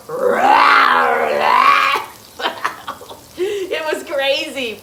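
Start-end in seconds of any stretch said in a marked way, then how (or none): 0.1-2.0 loud growling "Rah, rah" like a rumbling belly; stomach growl. Retold or imitated. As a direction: roar it out like a hungry stomach. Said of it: "my stomach was just going".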